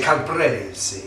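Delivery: drawn-out Italian-style Calabrese; Italian accent. Shown as a demonstration. Host showing off his favourite pronunciation, Italian accent. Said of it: "misappropriation of an Italian accent".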